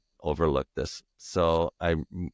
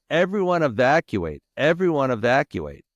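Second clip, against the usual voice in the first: imitating a loudspeaker announcement, louder; mock megaphone call to the street; 7 semitones higher, 5 dB louder than usual. Firefighters calling over the loudspeaker, loud imitated announcement. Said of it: "calling out on the loudspeaker".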